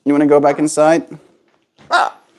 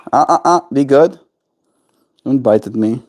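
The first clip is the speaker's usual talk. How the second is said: normal talking voice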